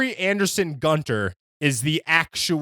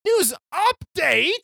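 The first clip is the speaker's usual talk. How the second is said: surprised; 13 semitones higher, slower than usual. Real, not acted. Incredulous echo of the news update.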